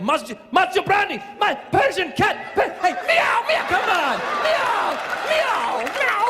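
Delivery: very high, loud frantic shouting and meowing; fan losing his mind. Retold or imitated. Jordanian fan spotting the comedian, hysterically excited and high. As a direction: shriek it like an overexcited fan. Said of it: "this guy just lost his mind".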